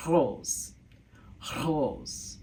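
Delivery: rose with a French throaty R. Shown as a demonstration. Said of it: "Oh, my R".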